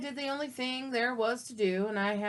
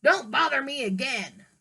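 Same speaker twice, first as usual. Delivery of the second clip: loud yelling pig; rude pig shout; 2 semitones higher, 8 dB louder than usual. Acted. Pig yelling at the wolf from inside, loud and rude. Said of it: "what that rude little porker answered".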